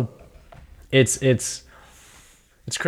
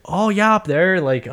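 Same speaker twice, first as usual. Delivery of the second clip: high-pitched attempt at a Welsh accent; bad Welsh accent; 8 semitones higher than usual. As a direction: have a clumsy go at Welsh, pitched up. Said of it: "I can't do a Welsh accent".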